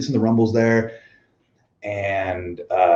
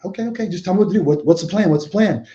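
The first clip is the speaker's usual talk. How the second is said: anxious high questions; 9 semitones higher, faster than usual. Retold or imitated. Retelling panic at a changed plan.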